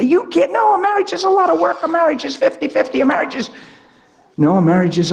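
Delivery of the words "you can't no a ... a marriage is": high-pitched mock protest; objecting psychologist. Psychologist correcting a young believer on marriage, protesting. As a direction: imitate an indignant expert objecting. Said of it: "psychologist would try to crucify you for this and say".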